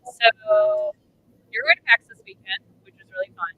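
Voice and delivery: glitchy robotic mic audio; choppy digital robot voice, like a broken connection. Real, not acted. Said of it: "You sound like a robot".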